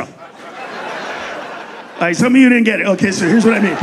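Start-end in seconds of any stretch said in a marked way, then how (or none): none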